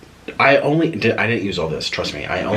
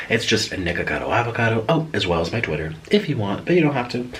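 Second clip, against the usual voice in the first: normal talking voice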